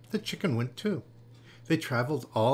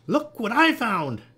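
high, loud, drawn-out call; shouting; 9 semitones higher, 7 dB louder, slower than usual. A narrator's reading. Jessica shows the frogs her latest find, shouting. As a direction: call it out proudly across the island. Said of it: "return at the end of the day shouting".

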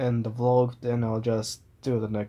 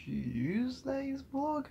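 stretched-out, much higher, softer mock disbelief; incredulous high question; 13 semitones higher, 6 dB quieter, much slower than usual. Vlogger quizzing himself, drawn-out high unbelieving question. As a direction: drag it out, high and puzzled. Asks himself, then answers yeah.

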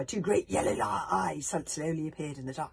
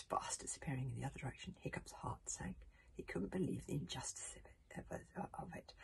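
hushed sad; 4 semitones lower, 10 dB quieter than usual. A narrator's reading. Heart sinks, hushed narration.

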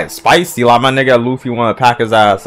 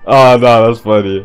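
ordinary talk

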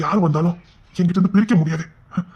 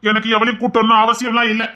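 loud, higher angry shout; a husband snapping loudly at his wife; 6 semitones higher, 4 dB louder than usual. Acted. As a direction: shout it right back at her. Shouting in quarrel.

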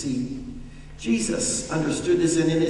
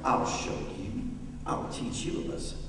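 a touch of defiance; 6 semitones lower, 6 dB quieter than usual. Pretend. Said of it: "Jesus could have been very defiant".

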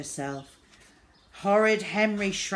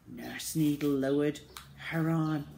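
ordinary delivery

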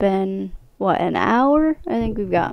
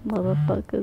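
laughing shout; 11 dB louder than usual. Real, not acted. Startled by jump scare.

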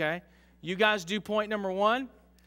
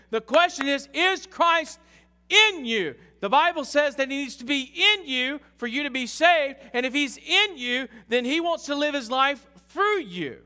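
higher, louder preacher's climb; fervent preaching; 8 semitones higher, 6 dB louder than usual. Acted. Pastor challenges the congregation, fervent preaching. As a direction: press the question on the church.

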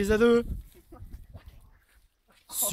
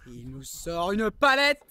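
normal talking voice

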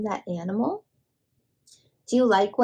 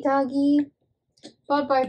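ordinary delivery

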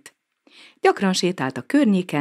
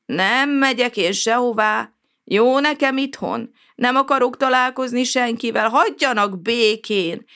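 a grumpy recluse refusing to budge; cranky old neighbour snapping back; irritated retort; 7 semitones higher than usual. A narrator's reading. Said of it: "vágott vissza ingerülten Csótány bácsi".

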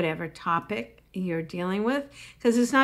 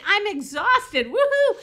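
much higher and louder, whooping the complaint; cheering a complaint; 10 semitones higher, 7 dB louder than usual. Shown as a demonstration. Comic showing how to woohoo a complaint, loud and high. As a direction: turn the gripe into a celebration. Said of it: "I like saying".

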